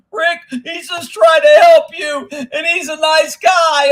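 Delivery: loud, high mock pleading; exaggerated high-pitched mimicry of a critic; mocking shout. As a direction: imitate a whining defender, stretched out and shouted. Mocks viewers defending the emailer.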